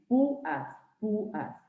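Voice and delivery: "púas" split in two, pú-as, stress on ú; broken diphthong. Shown as a demonstration. Teacher giving examples of a hiatus, púas split into syllables. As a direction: separate the vowels of "púas", leaning on the ú. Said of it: "el diptongo se rompe".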